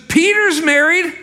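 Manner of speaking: high, loud, slow quoted Paul. Retold or imitated. Preacher voicing Paul about marriage, high and loud. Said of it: "He goes".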